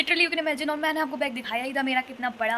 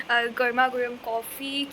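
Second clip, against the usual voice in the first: ordinary delivery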